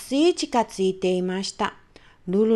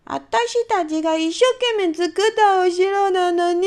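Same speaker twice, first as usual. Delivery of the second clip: mild tearful wail; 8 semitones higher, 4 dB louder than usual. Acted. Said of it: "大きな声で泣き出して".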